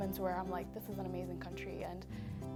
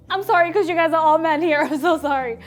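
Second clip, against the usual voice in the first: loud, high apology through laughter; laughing apology; 8 semitones higher, 17 dB louder than usual. Real, not acted. Interviewee to the men in the room, laughing apology. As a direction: burst out apologizing, laughing loudly.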